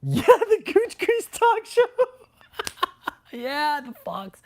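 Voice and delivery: high, slow, laughing through words. Real, not acted. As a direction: squeak it out mid-laugh. Cracking up recalling show.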